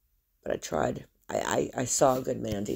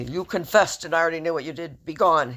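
loud dismissal; 4 semitones higher, 8 dB louder than usual. Pretend. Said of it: "God's not gonna say".

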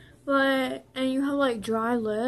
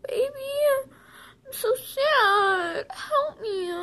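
high, slow, whiny mock sobbing; put-on weepy begging for sympathy; fake crying; 11 semitones higher, slower than usual. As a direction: plead tearfully, laying on the pretend sadness. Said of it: "But you're crying, and you're like".

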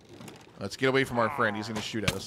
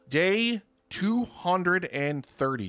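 announcer; 5 semitones higher, much slower than usual. Acted. Announces the day count.

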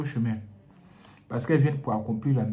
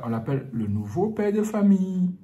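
ordinary delivery

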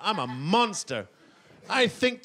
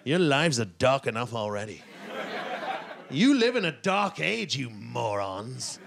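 pitying contempt; 7 semitones lower than usual. Retold or imitated. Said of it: "I looked at them and I thought".

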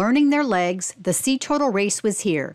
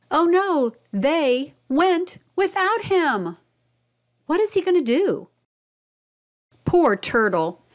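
put-on higher, dismayed aside for a child; oh no, a storyteller's mock worry; 6 semitones higher than usual. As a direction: gasp it out as if the turtles really left him. Worried aside about the lost turtle.

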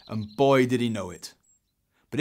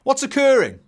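high, loud dad's question; calling out; 9 dB louder than usual. Acted. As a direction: sing out the question as Dad. Dad calling out to son.

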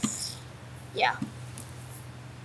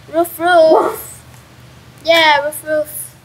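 playful loud mock barks; raised doggy woofs in a human voice; imitating a dog; 4 semitones higher, 6 dB louder, slower than usual. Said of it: "my dog is growling like a doggy".

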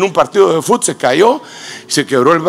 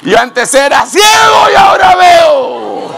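preaching at full force; 10 semitones higher, slower than usual. Acted. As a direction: lift the crowd with a shouted praise. (predicando a todo pulmón)

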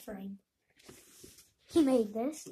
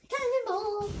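cannonball yell; 11 semitones higher than usual. Real, not acted. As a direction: yell it as you leap. Jumping into the foam pit.

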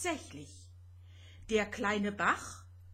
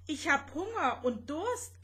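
high-pitched little complaint; complaining; 6 semitones higher than usual. A narrator's reading. Rosetta telling her companion she's hungry, complaining. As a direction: moan it with the pitch raised. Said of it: "klagte Rosetta schließlich".

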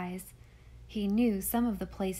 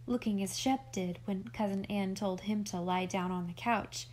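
plain reading voice

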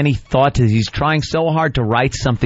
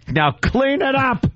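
mock command; 14 semitones higher, slower than usual. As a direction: act out a bossy order, pitch jumping at the end. Acts out the pumpkin pie prank.

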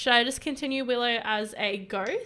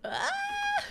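very high, thin squeal; dismayed squeal. Real, not acted. Plea to Death failing.

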